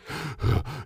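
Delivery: quick quiet gasp for air; out-of-breath gasp. Retold or imitated. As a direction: gasp like you're winded at the top of the stairs. You're sucking air.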